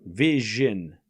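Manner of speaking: Vision drawn out with a zh in the middle; sounded out. Shown as a demonstration. Streamer asking how viewers say the S, sounded out. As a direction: stretch Vision to show the zh. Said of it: "how do you pronounce it?".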